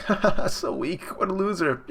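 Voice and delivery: high jeering cackle; put-on taunting laugh; mocking laughter. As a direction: imitate bullies laughing at a weakling. Brothers jeer at him.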